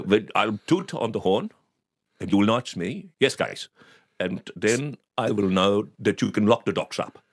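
imitated Dutch-accented English. Dutch customer asking about the dogs, Dutch accent. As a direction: do an old house painter's Dutch accent. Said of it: "I'm doing an accent".

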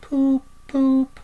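very high, drawn-out car-horn toots; entranced horn imitation. A narrator's reading. Toad in a trance after the car passes, high horn hoots. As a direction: toot dreamily like a motor horn. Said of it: "still heard to murmur".

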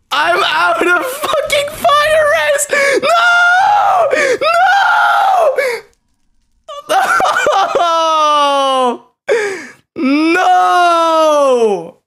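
very high, drawn-out wailing scream; anguished screaming. Real, not acted. Gamer out of fire resistance, anguished screaming. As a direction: shriek it in despair, nearly crying.

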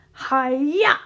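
loud, high ninja yell; ninja shout. A narrator's reading. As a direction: let out a loud battle cry. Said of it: "I shouted".

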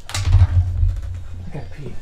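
slow drawn-out whisper. Real, not acted. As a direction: breathe it out slowly. Repeats slowly, voiceless.

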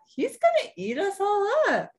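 very high bunny squeal; panicked warning. A narrator's reading. Dot warns her parents about Wolfie, panicked.

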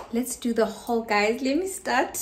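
normal talking voice

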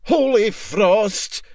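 high, loud, slow cry of shock; startled exclamation. Acted. MacPhail jumps back on deck, startled and loud. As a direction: blurt it out, caught off guard. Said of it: "he exclaimed".

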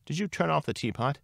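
high-pitched worried question; anxious. A narrator's reading. Neurosis checks on Lily Bunny at the door, anxious. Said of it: "it asked anxiously".

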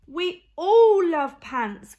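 loud, high, slow happy cheer; cheerful cheer. Acted. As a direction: cheer it out to calm the dinosaurs. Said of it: "one alien cheered".

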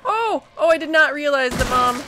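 high yelp, then loud surprise; real jolt of surprise; startled. Real, not acted. Startled finding monster right there.

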